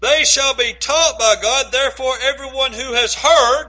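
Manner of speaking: "heard" punched out loud; stress on heard. Real, not acted. Preacher quoting scripture to the congregation, "heard" emphasized. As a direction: build through the verse and land hard on "heard". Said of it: "now emphasize that word heard".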